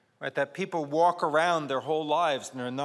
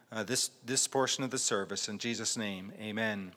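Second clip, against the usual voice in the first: hushed, reverent opening prayer; 3 semitones lower, 4 dB quieter than usual. Acted. Pastor praying quietly over the reading, reverent. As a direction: pray softly over the reading.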